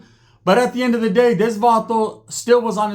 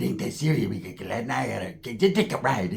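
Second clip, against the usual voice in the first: low mumble; 9 semitones lower, 7 dB quieter than usual. Retold or imitated. Mumbled retold line.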